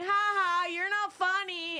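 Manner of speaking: high, loud sarcastic ha ha; mock him with a fake laugh; high fake sneering laugh. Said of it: "She's mocking me".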